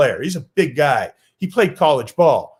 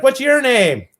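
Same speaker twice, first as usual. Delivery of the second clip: put-on little kid voice; 9 semitones higher, 4 dB louder than usual.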